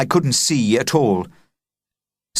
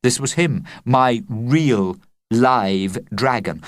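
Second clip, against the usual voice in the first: ordinary delivery, nothing marked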